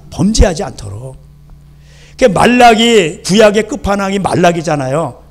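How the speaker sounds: ordinary talk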